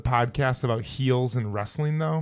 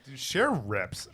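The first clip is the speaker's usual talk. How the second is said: ordinary delivery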